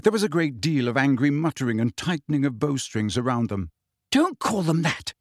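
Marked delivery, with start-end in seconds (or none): 4.1-5.1 higher-pitched, contained anger; mildly furious. A narrator's reading. Hermione rebuking Umbridge for the slur, mildly furious. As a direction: scold with a touch of fury. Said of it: "Hermione said furiously".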